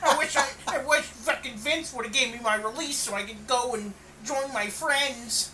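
mock a sulking wrestler; whiny Roddy impression. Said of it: "and then Roddy's just like".

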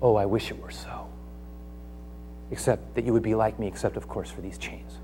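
soft and low, ending in a whisper; wistful, let it die away; Paul answering the king, trailing off to a whisper; fading to a whisper. Acted. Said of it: "And Paul says".

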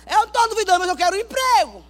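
réplica gritada e aguda a Deus; protesto exaltado. Acted. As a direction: rebata em alta voz, insistindo no pedido. (protesto teatral aos brados)

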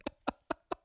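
real breathless laughing fit; helpless laughter at oneself. Real, not acted. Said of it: "I'm laughing at".

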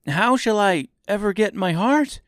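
high-pitched anxious question; dismayed. A narrator's reading. Tin woodman despairing of his heart, dismayed voice.

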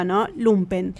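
ordinary delivery

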